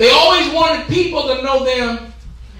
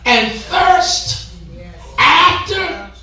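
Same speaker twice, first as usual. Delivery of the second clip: preached scripture; 4 semitones higher, much slower than usual. Acted. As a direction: stretch it out high toward the last word.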